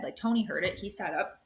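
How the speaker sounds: ordinary talk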